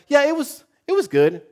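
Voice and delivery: high-pitched, offhand mom voice; mom's breezy answer. Retold or imitated. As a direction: voice a mom casually brushing it off.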